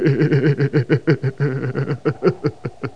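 long scornful cackling laugh; jeering laugh. Acted. Sniper mocking the captain, long scornful laugh. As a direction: laugh at him from above.

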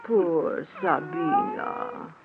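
very soft, slow croon; baby the cat tenderly; cooing to cat. Acted. Wife comforts the injured cat, soft slow croon.

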